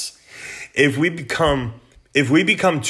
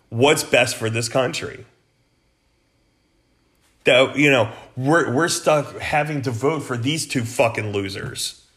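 ordinary talk, nothing marked

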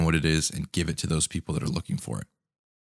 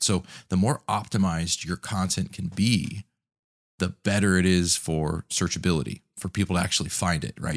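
ordinary talk

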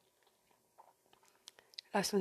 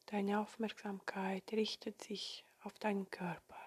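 soft spa whisper; 9 dB quieter than usual. Acted. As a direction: whisper them gently into calm. Leads a guided meditation.

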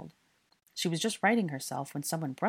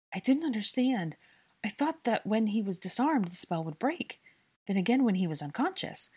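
raised, distressed explanation; rattled off; 5 semitones higher than usual. A narrator's reading. Hermione explaining to Draco after the fright, anxiously rattling off. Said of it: "she rattled off".

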